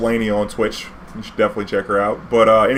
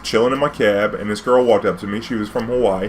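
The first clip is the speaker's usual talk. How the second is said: ordinary talk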